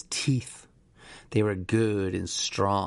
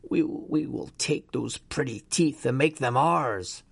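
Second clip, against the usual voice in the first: an eerie, otherworldly demand; demanding inner voice; 3 semitones higher than usual. A narrator's reading. The voice in Dave's skull demands his teeth, eerie. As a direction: insist like a hungry thing inside him. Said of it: "the voice in his skull demanded".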